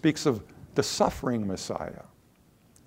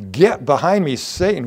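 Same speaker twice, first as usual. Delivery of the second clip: raised sharp rebuke, louder on Get; Jesus snapping at Peter to step back; stern rebuke; 4 semitones higher, 7 dB louder than usual. Retold or imitated. Jesus rebuking Peter, a loud stern command. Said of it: "He begins to rebuke Peter".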